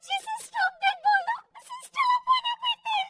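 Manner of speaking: put-on high-pitched young daughter-in-law, tickled; giggly excitement. Daughter-in-law tells mother-in-law about funny TV serial, giggly excitement.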